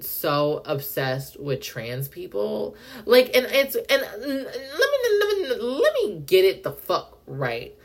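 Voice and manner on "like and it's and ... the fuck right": laughing through the words in disbelief; half laughing, half crying in exasperation; exasperated laugh. Real, not acted. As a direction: crack up mid-rant. Exasperated, laughing while ranting.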